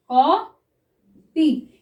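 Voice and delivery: very drawn-out word while writing it; slow dictation. Shown as a demonstration. Teacher dictates copy to shorthand students, very slow. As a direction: stretch each sound for the class. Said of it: "ये हो गया हमारा ए".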